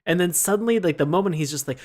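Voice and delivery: ordinary talk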